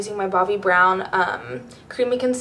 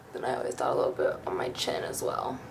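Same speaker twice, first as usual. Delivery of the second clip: whispering; 8 semitones lower, 9 dB quieter than usual. Real, not acted. Quiet aside while applying bronzer.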